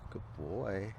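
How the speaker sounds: ordinary delivery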